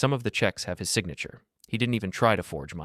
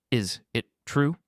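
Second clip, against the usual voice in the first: each word slow, sharp and separate; sharp and distinct; 6 semitones higher than usual. A narrator's reading. Wife demanding the truth from her son, sharp and distinct. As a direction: bite off every word of the question. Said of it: "Each word was sharp, distinct".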